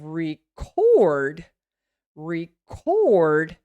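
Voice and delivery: "record" as re... COORD; short "re", then hold CORD in "record"; long stressed second syllable. Shown as a demonstration. Teacher showing the verb's longer syllable, stressed CORD in "record". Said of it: "the syllable cord is going to be longer".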